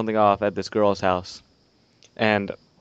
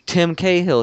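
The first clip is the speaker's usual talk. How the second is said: surprised recognition; 8 semitones higher, 5 dB louder, slower than usual. Retold or imitated. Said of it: "I'm go".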